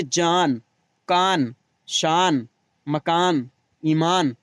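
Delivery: full final n in "जान, कान, शान"; word list with the n of "जान" fully pronounced; clearly sounded noon. Shown as a demonstration. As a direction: finish "मकान, ईमान" on a distinct n. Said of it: "जो नून साफ साफ पढ़ा जाए".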